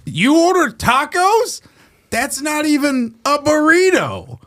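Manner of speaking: very high, slow mock outrage; hacky joke delivery. Customer confronts the man over his tacos, hacky mock outrage. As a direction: draw it out in hammed-up, high-pitched indignation. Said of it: "like a Tim Heidecker joke".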